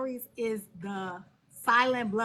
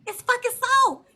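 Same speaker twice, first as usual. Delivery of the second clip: exasperated; 11 semitones higher, 5 dB louder than usual. Real, not acted. Exasperated at salt-loving cohost.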